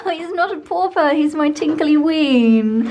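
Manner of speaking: high, slow doting coo to a pet. Real, not acted. To her beloved dog, high doting baby talk.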